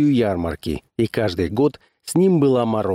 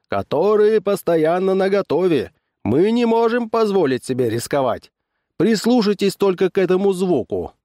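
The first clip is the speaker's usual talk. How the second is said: high-pitched barker's patter; fairground barker; 7 semitones higher than usual. A narrator's reading. Barker selling his show to the crowd, showman's patter.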